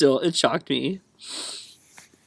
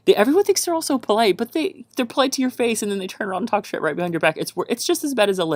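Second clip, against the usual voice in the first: heated rant; 9 semitones higher, faster than usual. Real, not acted. Ranting about Southern fakeness.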